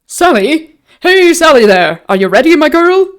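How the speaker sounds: high, loud call to another room; lusty shout. A narrator's reading. Innkeeper calling Sally in the kitchen, lusty shout. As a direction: holler heartily, pitched well up. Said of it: "shouted lustily".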